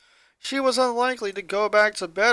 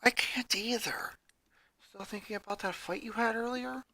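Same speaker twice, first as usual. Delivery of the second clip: breathy hushed whisper; 5 semitones higher, 11 dB quieter than usual. Acted. Ronnie Ann whispering her question to Lincoln. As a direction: keep it to a whisper in the dark. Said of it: "she whispered back".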